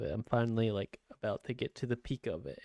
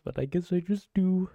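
higher and louder, exasperated; 9 semitones higher, 5 dB louder than usual. Real, not acted. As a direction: throw it out loud and high. Repeats own line, then repeats softer.

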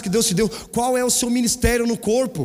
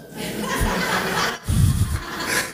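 high breathy laugh; 10 semitones higher than usual. Real, not acted. Laughs at son's question.

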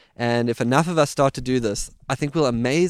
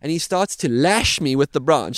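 usual speaking voice

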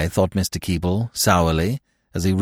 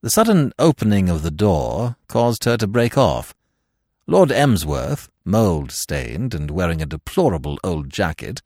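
plain reading voice

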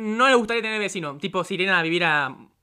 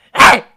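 sudden loud mock "eh!"; imitated silly yelp; 3 semitones higher, 13 dB louder than usual. Guest imitating the don't-laugh TikToker, sudden loud yelp. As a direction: burst out with an abrupt, loud yelp.

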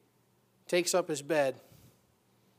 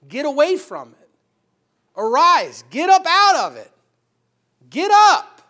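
loud, high "Arise", "Get up"; shouted command; 8 semitones higher, 14 dB louder, slower than usual. Acted. Preacher shouts the call.

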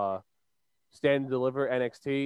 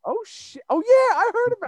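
much higher, sudden "Oh, shit" of recognition; surprised; 6 dB louder than usual. Real, not acted. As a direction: caught off guard, voice jumping up. Reacts to celebrity neighbor news.

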